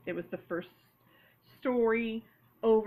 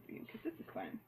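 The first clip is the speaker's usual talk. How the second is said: whispered aside; 13 semitones lower, 11 dB quieter, faster than usual. Real, not acted. Aside while crafting.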